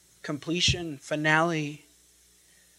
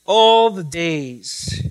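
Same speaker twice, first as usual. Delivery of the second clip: slow and louder "All the days"; louder slow repeat; 7 dB louder, much slower than usual. Shown as a demonstration. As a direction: hammer "All the days" home slowly. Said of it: "Literally, it reads".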